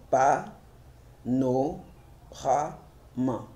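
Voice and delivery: "pa, no, ra, ma" counted out in separate syllables; pauses between pa, no and ra. Shown as a demonstration. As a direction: break panorama into pa, no, ra, ma. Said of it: "combien de syllabes là-bas?".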